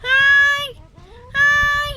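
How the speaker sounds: high loud sing-song hi; excited greeting. Real, not acted. (masiglang bati sa mga aso)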